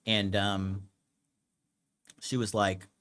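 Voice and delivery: normal talking voice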